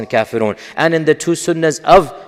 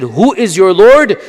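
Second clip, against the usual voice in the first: "Who is your Lord?" asked higher, louder and slower, demanding; challenging question; 5 semitones higher, 5 dB louder, slower than usual. Retold or imitated. Lecturer voicing the doubters' challenge to the Prophet, louder and demanding. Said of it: "a response when they said".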